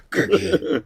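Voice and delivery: growly puppet monster voice; Cookie Monster voice. Shown as a demonstration. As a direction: say it like a gravelly monster. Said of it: "your Cookie Monster voice".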